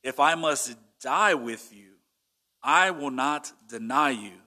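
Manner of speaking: slow, emphatic vow. Retold or imitated. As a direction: declare it slowly, weighting each phrase. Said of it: "But he said emphatically".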